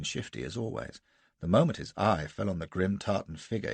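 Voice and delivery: ordinary talk, nothing marked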